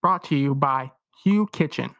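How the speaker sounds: loud, upbeat sponsor read. Acted. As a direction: sell it like a radio ad. Host reads sponsor ad.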